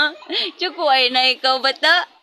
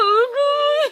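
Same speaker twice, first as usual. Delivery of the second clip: mock dismay; 13 semitones higher than usual. Real, not acted. Reacting to baby's tears.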